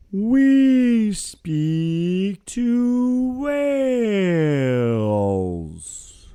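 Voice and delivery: slow stretched high whale call. Acted. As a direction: draw out the words like a singing whale. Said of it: "so Rob can do his whale voice".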